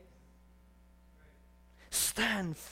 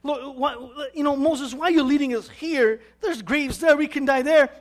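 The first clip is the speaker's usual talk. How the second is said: high-pitched, loud protest to a leader; complaining; 7 semitones higher, 8 dB louder than usual. Acted. Israelites to Moses in the desert, complaining loudly. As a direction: whine it out, pushing louder toward the end. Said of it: "They start to complain".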